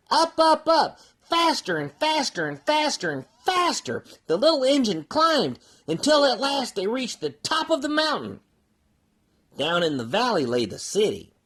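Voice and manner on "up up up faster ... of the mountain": higher and higher as the train climbs; building excitement. A narrator's reading. Narrating the climb to the top, rising excitement. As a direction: lift your voice with each up.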